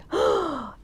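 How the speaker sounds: imitated crowd gasp; sharp mock gasp of horror; shocked gasp. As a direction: gasp in shock like a whole audience. Imitating the shocked audience's reaction.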